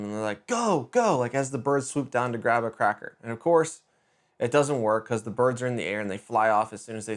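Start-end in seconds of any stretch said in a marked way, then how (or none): 0.5-1.2 high, half-voiced cheer of a yell; retold yelling. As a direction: urge him on like cousins yelling. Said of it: "starting to yell at him".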